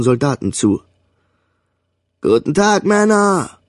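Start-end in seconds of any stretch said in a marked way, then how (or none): 2.2-3.6 high, ringing parade-ground bellow; bellowed greeting. A narrator's reading. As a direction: roar it across the whole squad. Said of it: "brüllte er".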